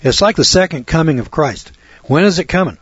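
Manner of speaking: ordinary delivery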